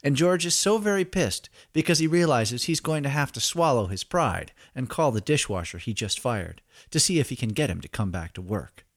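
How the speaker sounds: usual speaking voice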